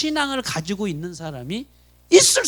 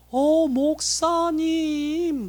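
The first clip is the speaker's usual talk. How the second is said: very drawn-out, higher-pitched mock-polite greeting; affected greeting; 5 semitones higher, much slower than usual. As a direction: stretch every syllable in put-on politeness. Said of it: "말하는 것도".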